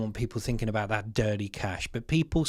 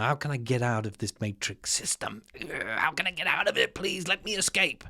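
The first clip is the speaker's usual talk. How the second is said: softer, pleading mock inner voice; desperate inner voice; 4 dB quieter than usual. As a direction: voice the trapped saver's plea, hushed. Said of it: "the plans in people's minds now".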